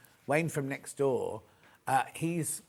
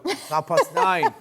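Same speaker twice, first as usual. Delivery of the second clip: high, slow teasing tone; 13 semitones higher, 8 dB louder, slower than usual. Real, not acted. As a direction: tease her about sleeping in. Teasing a viewer.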